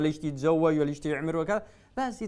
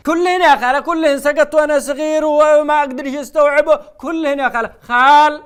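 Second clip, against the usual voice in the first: loud mocking echo; loud mock confession; 6 semitones higher, 8 dB louder than usual. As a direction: throw her insult back loudly. Host mocks the caller's insult loudly.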